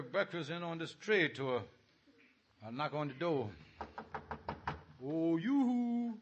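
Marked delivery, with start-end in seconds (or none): 5.0-6.1 sugary yoo-hoo. Acted. Knocking at mother-in-law's door.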